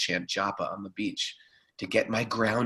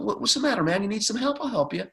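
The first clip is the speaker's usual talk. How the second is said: much higher, mock-cool offer of help; breezy, pitched up, pretending it's fine; casual offer; 12 semitones higher, faster than usual. Friend offering help to the drowning publicist. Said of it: "and I was like".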